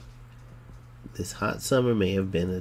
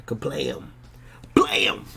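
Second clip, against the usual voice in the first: voiced gunshot "kablam"; spoken sound effect; 7 semitones lower, much slower than usual. Shown as a demonstration. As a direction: make the blast noise yourself. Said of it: "they didn't even put a sound effect on there".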